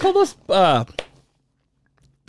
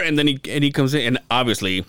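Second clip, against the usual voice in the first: ordinary talk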